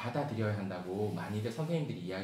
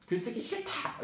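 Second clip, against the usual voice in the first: put-on high, whiny child's refusal, higher in pitch; stubborn child; 12 semitones higher than usual. Child refusing the writing task, high whiny protest. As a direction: whine it like a kid who refuses to write. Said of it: "이렇게 썼어요".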